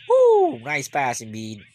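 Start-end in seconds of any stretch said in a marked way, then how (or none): 0.1-0.6 loud very high whoop; cheering woo. Real, not acted. Fan cheers a nice pass, whooping loud and high.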